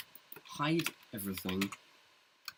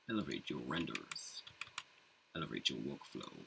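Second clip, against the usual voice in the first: deep ad announcer tagline; trailer voice; 10 semitones lower, 6 dB quieter than usual. Pretend. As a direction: sell it like a movie trailer. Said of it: "You sound like the, uh, trailer guy".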